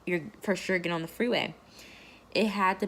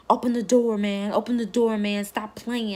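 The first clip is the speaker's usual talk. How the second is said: loud, higher mock shout of the husband; retold angry yelling; 4 semitones higher, 7 dB louder than usual. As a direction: bang out the demand like he's at the window. Husband pounding on car door.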